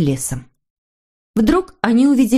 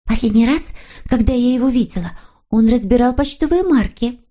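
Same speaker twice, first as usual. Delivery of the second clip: put-on high girlish storybook voice; girlish character; 5 semitones higher than usual. A narrator's reading. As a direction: recall it in a raised, girlish voice. Snork Maiden voiced in story. (повышенный девичий голос)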